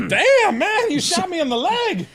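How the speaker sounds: high, loud yelp of a man just shot; mock shot victim. Host imagining the shot man crying out, high loud yelp. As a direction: squeal it out like you've just been hit. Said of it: "you'd at least see him go".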